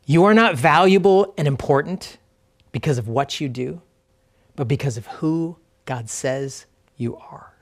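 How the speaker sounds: slow reflective reading. Real, not acted. Pastor reading truths for the congregation to remember, slow and reflective. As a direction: read slowly, with long pauses to let each truth land. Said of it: "I'm just gonna read them slowly".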